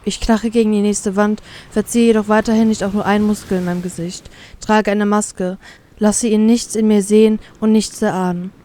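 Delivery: plain reading voice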